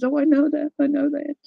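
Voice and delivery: gently echoing her granddaughter; granddaughter's voice. Retold or imitated. Granddaughter quoted again.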